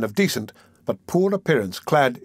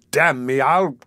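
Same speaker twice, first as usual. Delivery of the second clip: mildly raised, angry outburst; bursting rage; 3 dB louder, slower than usual. A narrator's reading. Old gentleman cursing the magistrate, bursting with rage. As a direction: let held-back anger burst out. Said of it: "bursting out with rage he had kept down so long".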